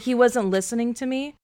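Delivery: ordinary talk, nothing marked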